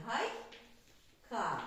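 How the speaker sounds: Гай- ка with a pause after гай, Й kept with гай; syllable split. Shown as a demonstration. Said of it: "як перенести".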